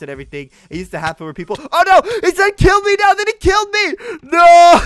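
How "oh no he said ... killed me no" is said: high, loud wailing shout; anguished shout. Real, not acted. Gamer killed by a fake fan, anguished shout. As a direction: howl it out as you get betrayed. Tricked and killed mid-fight.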